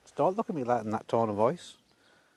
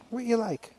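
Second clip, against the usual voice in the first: much higher sing-song "What you like?" to a pet; mock scolding pet talk; 9 semitones higher than usual. Real, not acted. To the horse that dropped it all, high fond teasing.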